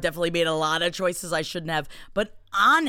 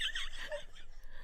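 silent wheezing laugh; 16 dB quieter than usual. Real, not acted. Breaks down laughing mid sign-off.

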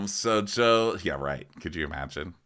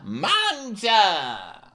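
mock Italian; 12 semitones higher, 3 dB louder than usual. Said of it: "And I said".

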